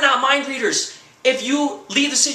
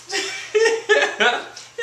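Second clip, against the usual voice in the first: high, bursting laugh at his own joke; laughing at his own rant; cackling laugh; 9 semitones higher than usual. Real, not acted. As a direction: crack up at the punchline.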